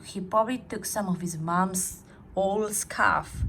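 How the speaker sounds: ordinary delivery, nothing marked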